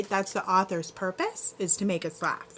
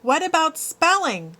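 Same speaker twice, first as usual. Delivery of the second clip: mild shout; 8 semitones higher, 4 dB louder than usual. A narrator's reading. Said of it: "shouts Randy Potts".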